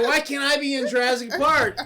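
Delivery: loud, high mock whine; hammed-up kid's longing; childish pleading. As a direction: wail it like a kid wishing hard. Acting out childhood longing, loudly.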